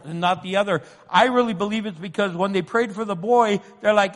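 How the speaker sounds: ordinary talk, nothing marked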